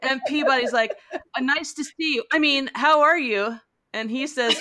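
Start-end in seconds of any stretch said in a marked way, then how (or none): 1.1-3.6 high-pitched imitation of a tongue-tied aide; mock flustered. Aide greets the towel-clad husband, mock flustered. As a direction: stumble over it, acting starstruck.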